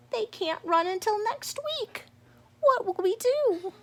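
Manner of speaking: high, fretting rabbit voice; worried Missus Rabbit. Acted. Missus Rabbit fretting to the chief, high worried voice. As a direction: fuss and worry.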